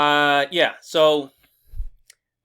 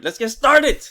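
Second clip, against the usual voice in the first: hyped, higher and louder; excited; 9 semitones higher, 7 dB louder, slower than usual. Real, not acted. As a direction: pump it up. Hyped repeat of opener.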